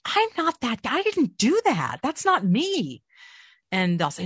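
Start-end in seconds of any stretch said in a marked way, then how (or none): 0.1-3.0 high, drawn-out protest; insistent denial. Retold or imitated. Actor tells agent it was someone else, insistent denial. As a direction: deny it slowly, pitch swooping up.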